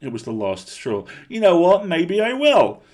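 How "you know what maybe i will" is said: high, loud furious shout; defiant shout. A narrator's reading. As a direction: hurl it at him and get up. Last straw, defiant outburst.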